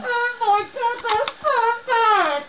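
high, crying wail; high pitched tearful cry. Acted. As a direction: whimper it out like you're about to sob. Distraught over botched implants.

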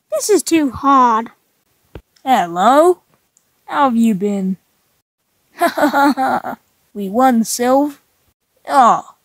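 usual speaking voice

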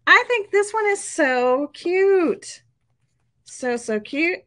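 high, drawn-out gushing over something cute; gushing delight. Real, not acted. Gushing over her favorite pot holder.

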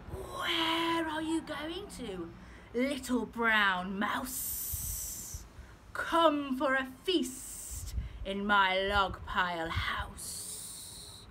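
very slow, drawn-out snake voice; hissing snake. Acted. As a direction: slide each word out like a snake. Snake voiced in read story.

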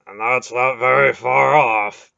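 a big man agreeing gravely; big warrior agreeing. A narrator's reading. Beric agreeing as the sunlight nears, slow and heavy. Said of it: "Beric agreed".

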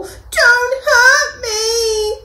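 high, slow, trembling plea; a big lion whimpering in fear. Acted. Frightened lion begging the mouse, high whimper. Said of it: "he whimpered".

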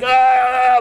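startled yelp in a sandstorm; mock cry of alarm. Preacher voicing blinded soldiers, mock cry. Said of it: "sitting there like".

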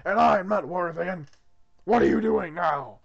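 loud, irritated God voice; exasperated God. Acted. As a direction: boom it out as a fed-up Lord. Plays God scolding Arthur.

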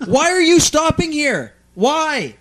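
high, loud angry yelling; re-enacted furious scream. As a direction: scream it at the other driver, outraged. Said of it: "I screamed at him".

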